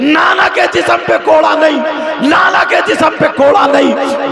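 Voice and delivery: high, loud, repeated declaration; fiery preaching cry. Acted. Preacher vowing no lash on the Prophet's body, loud. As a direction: hammer the vow home to the crowd.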